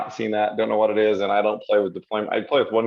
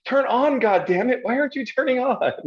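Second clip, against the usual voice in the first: high, exasperated pleading at the software; mock frustration; 14 semitones higher than usual. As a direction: yell at the button that won't switch on. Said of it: "I'm like".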